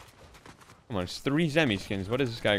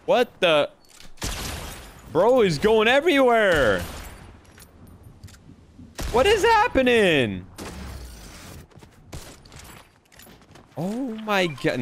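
shocked yelling; 12 semitones higher, slower than usual. Real, not acted. Enemy jumping around wildly.

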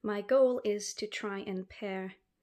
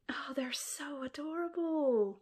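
gushing whisper; 6 semitones higher than usual. Real, not acted. Gushing over snails.

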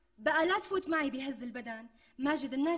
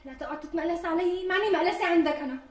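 louder, higher indignant reply; angry retort; 4 semitones higher, 7 dB louder than usual. Acted. Fiancée snapping at her groom over his mockery, loud indignation. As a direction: put him in his place.

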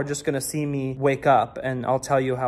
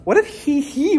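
high falsetto squeal on "he, he", then usual voice; 11 semitones higher, 4 dB louder than usual. Pretend. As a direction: pop star "he, he" slipped into the question. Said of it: "Is this the ghost of [name]".

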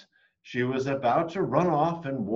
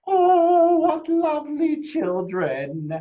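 high, sweet witch voice; old woman; 8 dB louder, slower than usual. A narrator's reading. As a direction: coo it like a sweet old crone luring kids. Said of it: "the old woman who lived there came out and said".

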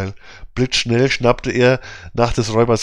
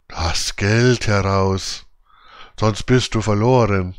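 hollow grim growl; slower than usual. A narrator's reading. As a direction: growl it out like a ghostly robber. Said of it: "zwei hohle Stimmen grausig brummen". (Räuber mit hohler, tiefer, langsamer Stimme)

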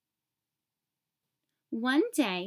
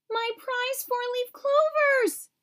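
shouting in dismay; 14 semitones higher than usual. A narrator's reading. Even Steven shouting at Odd Todd over the wrecked garden. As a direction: wail over the ruined clovers. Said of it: "he shouted".

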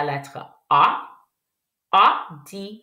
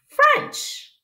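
French much higher, sung out; excited cheer. Acted. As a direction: lift French up high with delight. Teacher praising again.

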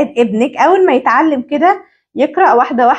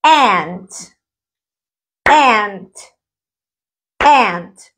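ANT said in one go, lower and falling; one-beat word; 4 semitones lower, much slower than usual. Shown as a demonstration. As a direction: say ANT as a single unbroken syllable. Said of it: "بقولها مرة واحدة".

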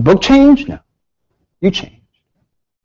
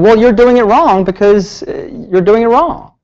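higher, mock-pompous insistence; know-it-all tech; 8 semitones higher than usual. Tech insisting to the student he saws wrong, mock-pompous. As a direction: play the smug shop tech.

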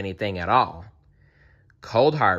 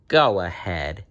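smooth coaxing. A narrator's reading. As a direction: urge with sly smoothness. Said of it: "he whispered smoothly".

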